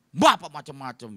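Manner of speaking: loud indignant snap from the offended woman; angry retort. Retold or imitated. Offended churchgoer scolding the pastor, loud and angry. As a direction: bark it back at him, annoyed. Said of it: "Dia marah".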